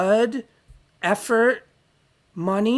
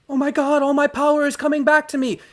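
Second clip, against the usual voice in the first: high, louder giddy outburst; mock manic rush; 7 semitones higher, 4 dB louder than usual. Imitating his manic past self to viewers, high giddy excitement. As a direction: gush like power is flooding back.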